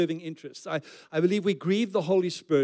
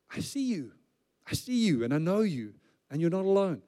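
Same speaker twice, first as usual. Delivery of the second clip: tender reassurance. Acted. God speaking to a lonely widow, tender reassurance. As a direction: let each phrase fall gently.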